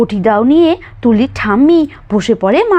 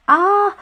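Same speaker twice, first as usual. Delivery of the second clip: pained gasp; 9 semitones higher than usual. Acted. Pricked by fish bone.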